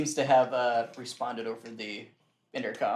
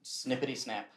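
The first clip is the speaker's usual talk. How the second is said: ordinary talk, nothing marked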